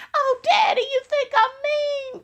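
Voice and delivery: hammed-up sugary plea to her husband; mock baby voice. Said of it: "she asks her husband".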